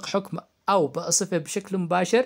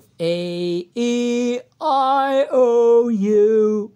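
vowels called out; 7 semitones higher, 9 dB louder, much slower than usual. Shown as a demonstration. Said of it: "ما هي الحروف المتحركة".

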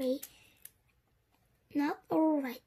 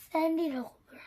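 usual speaking voice

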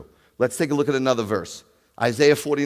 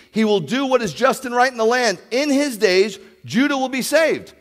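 higher, louder proclaiming read; preaching scripture; 7 semitones higher, 6 dB louder than usual. Acted. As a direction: thunder the prophecy out. Reads scripture with force.

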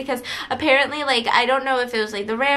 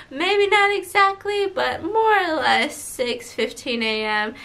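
sleepy pilot voice; slower than usual. Retold or imitated. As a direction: stretch every word like a drowsy pilot. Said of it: "that's how he was talking".

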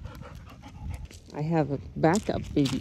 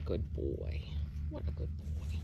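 soft praise; 11 semitones lower, 10 dB quieter than usual. Real, not acted. Whispering praise to the dog. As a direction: hush it out lovingly.

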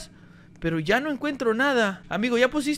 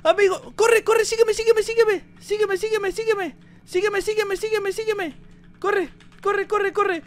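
high, loud urgent commands to a teammate; urgent excited calling; 7 semitones higher, 3 dB louder than usual. Real, not acted. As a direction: hurry him on at the top of your voice. Urging teammate to grab key and run. (llamado urgente y emocionado, agudo y fuerte)

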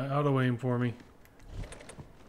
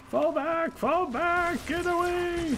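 shouting orders over battle; 3 dB louder, slower than usual. Real, not acted.

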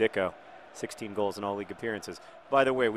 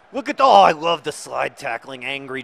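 delighted outburst; 2 semitones higher, 12 dB louder than usual. Real, not acted. Thrilled by a tackle.

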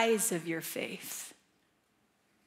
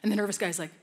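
normal talking voice

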